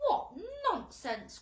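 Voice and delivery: loud, high, slow scoff; scornful cry. A narrator's reading. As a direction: dismiss it with contempt. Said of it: "cried the earthworm".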